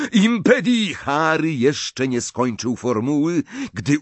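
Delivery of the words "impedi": high, loud cry; shouted spell. A narrator's reading. Harry attempting a spell on Snape, shouting. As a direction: yell a broken-off incantation. Harry casts mid-duel.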